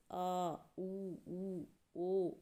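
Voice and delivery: आ, ऊ, ओ voiced softly from the back of the mouth; teacher demonstrating the back vowels आ, ऊ, ओ; back vowel demo. Shown as a demonstration. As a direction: sound out आ, ऊ, ऊ, ओ as pure back vowels. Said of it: "पीछे से आवाज़ आती है".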